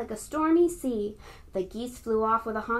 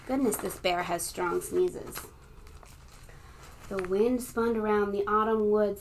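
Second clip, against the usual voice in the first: ordinary delivery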